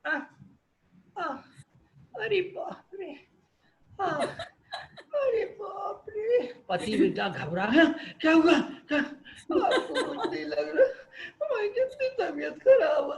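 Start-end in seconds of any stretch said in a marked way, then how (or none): 0.1-6.5 high drawn-out wailing groans; mock groans of pain. Wife in pain at night, hammed-up wailing. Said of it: "आवाज कैसी होती है, pitch कैसा होता है।". 8.2-13.2 panicky husband and whining wife. Acted. Husband and sick wife quarrel.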